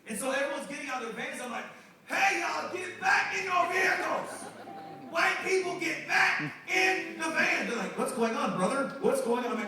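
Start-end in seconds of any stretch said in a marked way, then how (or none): 2.1-7.7 high, loud, stretched-out holler; urgent shout. Retold or imitated. Pastor ordering his staff back into the vans, urgent shout. 8.0-9.6 louder, higher, bewildered questions; alarmed questioning. Retold or imitated. Staff answering the pastor's yelling, alarmed questioning.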